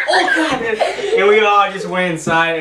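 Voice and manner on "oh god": shocked. Retold or imitated. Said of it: "And I'm like".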